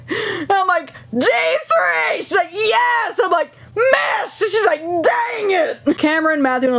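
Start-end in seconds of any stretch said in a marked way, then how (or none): 1.2-2.2 high mock yell; high-pitched retold shout; screaming over music. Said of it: "they're all screaming". 2.5-3.2 shouting over noise. Retold or imitated. Acted shouting over music. 3.8-4.4 high, stretched mock shout; high-pitched retold yell; shouting over noise. Acted shouting over music. 4.8-6.0 shouted frustration. Retold or imitated. Acted shouting over music.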